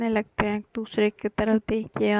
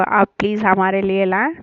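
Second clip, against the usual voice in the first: ordinary delivery, nothing marked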